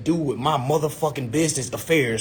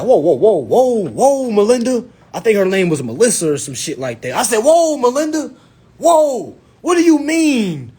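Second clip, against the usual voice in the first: hot-headed; 6 semitones higher, 5 dB louder than usual. Retold or imitated. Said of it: "in my hot head stage".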